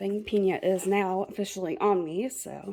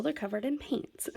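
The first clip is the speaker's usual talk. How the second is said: whispering; 3 semitones higher, 7 dB quieter than usual. Real, not acted. Aside about messy rags.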